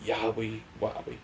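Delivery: quiet, quick creepy mock Fiend voice; a soft, eerie imitation of a wrestling villain; the Fiend's catchphrase. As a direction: hush it like a sinister puppet host. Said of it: "and you hear him say".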